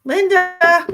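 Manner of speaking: high, loud sing-song call of a name; excited greeting. Real, not acted. Psychic greets a regular viewer excitedly. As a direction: call out the name brightly to a friend.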